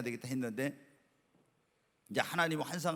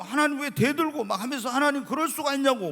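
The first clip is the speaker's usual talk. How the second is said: loud, high, indignant protest; resentful complaint to God; 10 semitones higher, 6 dB louder than usual. Retold or imitated. Believer resenting God, loudly talking back to him. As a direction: demand answers from God with rising anger. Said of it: "막 이런 거요".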